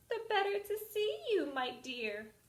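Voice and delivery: put-on high sweet old lady, wolfish underneath; fake granny voice. Wolf as Granny answers the girl, sweetly. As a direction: soothe her in the disguise.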